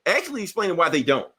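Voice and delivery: ordinary delivery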